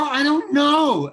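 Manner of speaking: slow, high, drawn-out "I don't know"; fed-up attitude. Retold or imitated. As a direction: sound fed up and ready to leave. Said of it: "that's the way I said".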